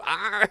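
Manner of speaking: high mock pirate growl; short hammed-up pirate cry; pirate arr. Pensioner expected to answer the child with a pirate arr. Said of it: "they don't talk back and go".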